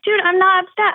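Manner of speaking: high-pitched mock indignation; acted-out denial in a squabble; retold defensive protest. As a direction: insist it, voice jumping up. Retells her protest to husband.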